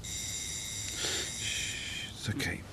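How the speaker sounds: hushed, gentle shushing; calm her down quietly; soft soothing whisper. Acted. Soothing upset wife.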